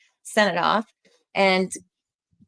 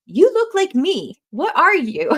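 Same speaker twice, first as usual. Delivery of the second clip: high, loud mock astonishment; imitating someone loudly taken aback; astonished stranger; 10 semitones higher, 6 dB louder, slower than usual. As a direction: exclaim it slowly like a baffled stranger. Quoting astonished strangers who approach dad.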